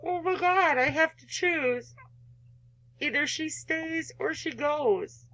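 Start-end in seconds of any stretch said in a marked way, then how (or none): 0.0-0.8 higher, startled oh my God; startled, high exclamation; dismayed gasp. Real, not acted. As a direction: caught off guard by the choice. Player faces a hard choice.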